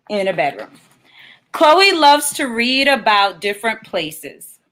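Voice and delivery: put-on lightly storybook read for kids, gently paced; reading to children. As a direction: read it like a picture book to little ones. Author reads picture book aloud.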